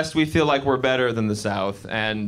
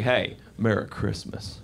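slow, low, pointed greeting, pause after "Hey"; veiled aggression; 7 semitones lower, 6 dB quieter, slower than usual. Retold or imitated. Southerner greeting him with a loaded pause, quietly aggressive. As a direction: hold back the hostility in a soft greeting. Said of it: "saying it kind of aggressive, and then there'd be a pause".

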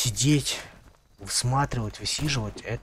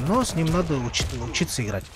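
normal talking voice

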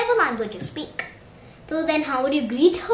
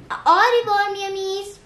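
sing-song goodbye; 7 semitones higher, 3 dB louder than usual. Shown as a demonstration. Teaching how to say bye to friends, sing-song. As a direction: call out a lilting farewell.

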